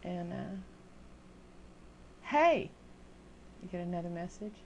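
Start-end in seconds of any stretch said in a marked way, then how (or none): 2.2-2.7 mock impatient hey.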